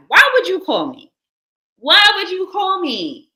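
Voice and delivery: annoyed streamer calling out a caller, exasperated. Real, not acted. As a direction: demand it of the caller, fed up. Annoyed at caller during live.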